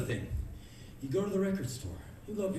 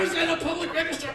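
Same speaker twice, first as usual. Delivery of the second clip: high, very loud screaming tirade; furious rant; 12 dB louder than usual. Pretend. Comic ranting at the customer, screamed fury. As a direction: scream it in a childish rage. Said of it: "I'm getting very angry".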